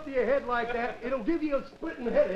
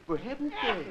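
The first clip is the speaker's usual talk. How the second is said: little bird voice; 3 dB quieter than usual. Acted. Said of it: "That's exactly the way she said it".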